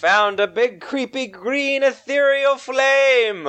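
high, slow eerie scene setting; spooky narration. Acted. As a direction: set a creepy scene slowly. Game master scene setting.

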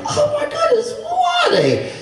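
very high-pitched, astonished squeal; acted surprise. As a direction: replay the shock in a squeaky high voice. Retelling surprise at news of visitors.

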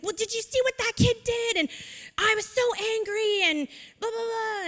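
high-pitched retold angry boy; whiny, indignant kid's complaint, not shouted; angry child. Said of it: "kind of was still very angry".

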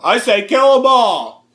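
high, drawn-out rallying shout; slow, high-pitched gung-ho yell; mock battle cry. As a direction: holler it like a movie war cry. Shouting a movie hero's line.

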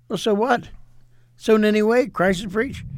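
mildly acted, animated quote of defiance; emphatic dismissal. Quoting Paul brushing off his rivals.

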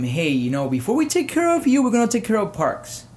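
high, loud mock voice of careless officials. Vlogger mocking the administration's message to victims, high sarcastic voice. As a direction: sneer it out like a tone-deaf bureaucrat. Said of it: "by telling them".

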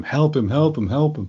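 half-hearted mock yell. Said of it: "Raz is just yelling at him".

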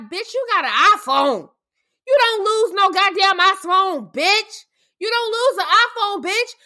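furious yelling. Real, not acted. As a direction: yell it in disbelief. Yelling at her over the iPhone.

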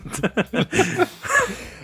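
high squeaky giggle. Acted. Laughing in the high voice.

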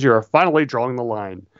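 normal talking voice

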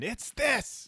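mock yell. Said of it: "right now Tim K is yelling".

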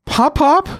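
cracks up high on Pop Pop; boy's voice cracking in fear. Acted. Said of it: "His voice cracks".

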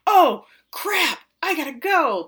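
high-pitched comic panic, drawn out; mock alarm. Retelling Lars realising he must get onstage, mock alarm. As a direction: act out a sudden oh-no moment.

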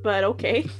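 usual speaking voice

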